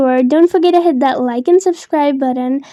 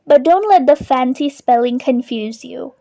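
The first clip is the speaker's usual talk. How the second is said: usual speaking voice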